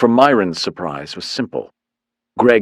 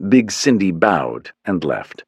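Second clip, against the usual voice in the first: usual speaking voice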